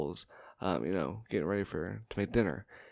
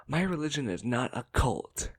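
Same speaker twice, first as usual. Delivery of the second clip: indignant aunt; 5 semitones higher, 5 dB louder than usual. Retold or imitated. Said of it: "she stops completely and goes".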